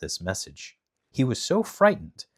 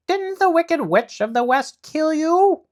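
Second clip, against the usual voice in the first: amazed guard; 8 dB louder than usual. Acted.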